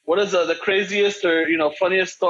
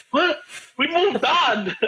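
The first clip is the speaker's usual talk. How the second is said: high-pitched retold disbelief; mock bafflement; 8 semitones higher, much slower than usual. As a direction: imitate puzzled friends, voice jumping up. Acting out friends rejecting late comeback.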